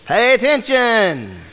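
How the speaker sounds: slow, drawn-out Pay attention; emphatic answer. Shown as a demonstration. Preacher answering his own question to the congregation, slow and emphatic. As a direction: land it slowly, stressing pay.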